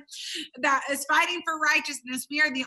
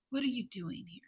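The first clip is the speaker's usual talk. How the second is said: asked much softer, tender and low; gentle soft question; 4 semitones lower, 9 dB quieter than usual. Acted. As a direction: ask it kindly, barely above a murmur. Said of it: "He was gentle".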